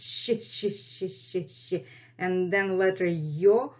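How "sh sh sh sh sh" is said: a long hissed sh; sh sound demo. Shown as a demonstration. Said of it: "most difficult letters to pronounce".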